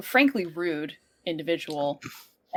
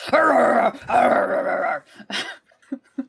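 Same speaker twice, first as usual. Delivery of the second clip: dog growling; 3 semitones higher, 9 dB louder, slower than usual. Pretend. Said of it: "And I'm just like".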